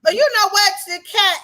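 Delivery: riding the laugh into the shout-out; excited. Real, not acted.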